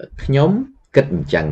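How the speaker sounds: ខ្ញុំ គិតអញ្ចឹង said high and rising, teacher model; model phrase, high. Shown as a demonstration. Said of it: "you say".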